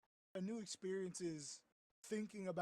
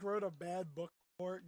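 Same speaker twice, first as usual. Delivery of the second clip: ordinary delivery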